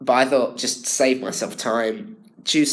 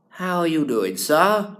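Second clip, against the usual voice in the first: high, lifted posh greeting; posh old gent; 8 semitones higher, 5 dB louder than usual. Retold or imitated. Old posh person greeting someone politely. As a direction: toff peering through a lorgnette. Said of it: "old posh people going".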